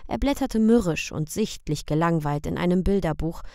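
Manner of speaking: ordinary delivery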